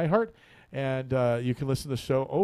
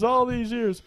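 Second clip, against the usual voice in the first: higher, mournful all these years; lamenting old saint; 9 semitones higher, slower than usual. Acted. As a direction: mourn the lost years, pitched up. Augustine lamenting.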